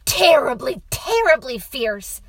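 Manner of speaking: put-on loud, emphatic child voice starting high; insistent boy. A narrator's reading. Boy corrects father about wolf, insistent.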